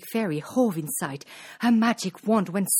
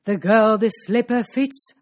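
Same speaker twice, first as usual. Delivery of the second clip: loud royal proclamation; princely cry; 7 dB louder than usual. A narrator's reading. Prince vows to wed slipper's owner, loud cry. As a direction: declare it loudly to the whole crowd. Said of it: "the prince cried".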